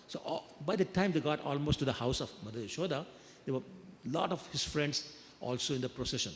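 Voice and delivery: usual speaking voice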